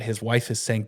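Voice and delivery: ordinary delivery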